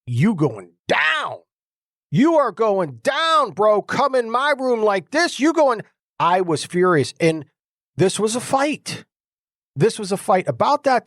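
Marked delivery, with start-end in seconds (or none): 0.0-5.8 rising defiant taunt at the demon, pitch climbing high; defiant threat. Retold or imitated. Man paralyzed by a demon defiantly threatens it. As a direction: square up and warn it, getting higher and hotter. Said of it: "I'm saying to this thing".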